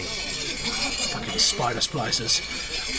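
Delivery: a slow, whispered curse at the enemies closing in; hushed curse. Acted. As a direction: hiss it under your breath so they won't hear. Enemies spotted, hiding nearby.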